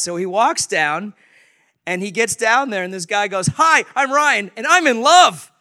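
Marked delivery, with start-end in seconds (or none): 3.5-5.5 loud, high, drawn-out gushing introduction; big, slow, high-pitched exuberance; super excited. Retold or imitated. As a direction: announce it like a lovestruck man bursting with joy. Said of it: "He's just super excited".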